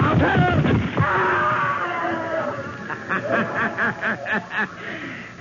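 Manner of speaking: gloating villain's high cackle; evil laugh. Acted. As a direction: cackle in triumph over the fall. Villain pushes man off terrace.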